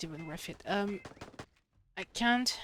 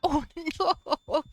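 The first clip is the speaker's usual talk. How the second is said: higher, louder laughing cry of dismay; 7 semitones higher, 7 dB louder than usual. Real, not acted. Gamer laughing at his own sudden loss, laughing dismay.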